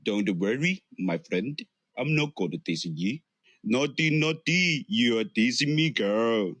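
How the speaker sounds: put-on Indian accent; playful imitation of an Indian accent; Indian accent impression. Shown as a demonstration. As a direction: tease in a mock Indian accent. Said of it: "I can imitate Indian accent".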